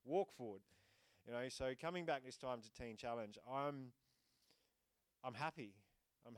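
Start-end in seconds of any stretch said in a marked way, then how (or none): 0.0-0.6 much louder, commanding and urging; loud divine push to keep walking; God's voice. Retold or imitated. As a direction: urge him forward like a voice from heaven. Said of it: "it's just like God going".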